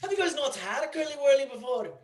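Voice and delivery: put-on high-pitched, dodgy British accent; mock British accent. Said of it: "I don't think that's a British accent".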